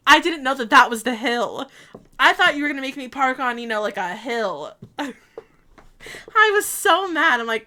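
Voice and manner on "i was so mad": laughing. Real, not acted. Laughing while recalling anger.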